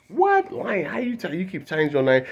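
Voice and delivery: What squeaked way up high, then falling back; startled yelp at a new name; mock disbelief. Church folk hearing his new name, mock disbelief.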